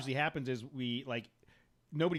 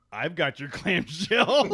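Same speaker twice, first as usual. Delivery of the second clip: laughing read; 5 semitones higher, 11 dB louder, much slower than usual. Retold or imitated. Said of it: "His wife in the chat room says".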